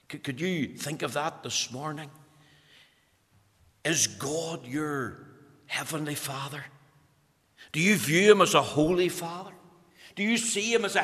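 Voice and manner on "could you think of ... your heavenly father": ordinary talk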